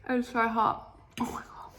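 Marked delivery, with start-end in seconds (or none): 1.2-1.7 whispering. Real, not acted. Food too hot.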